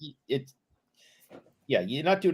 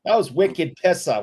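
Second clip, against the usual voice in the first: loud mock Boston accent, pitched up; 6 semitones higher, 8 dB louder than usual. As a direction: bellow it like an over-the-top Bostonian. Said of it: "you'd be better off going full Bostonian".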